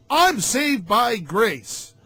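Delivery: slow, drawn-out mock declaration; mocking quote. Ranter imitates Christians who call him legalist, mocking.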